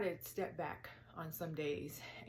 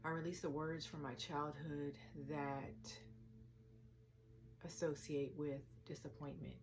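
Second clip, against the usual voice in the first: soft affirmations with long pauses; quiet, unhurried meditation voice; guided meditation; 8 dB quieter than usual. Acted. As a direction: speak each release gently, then let it settle. Leads a release meditation.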